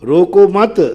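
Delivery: रोको मत as one lifted unit; no pause after रोको. Shown as a demonstration. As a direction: bind मत to रोको and stop after it. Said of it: "अब देखना, कुछ गड़बड़ नहीं करूँगा".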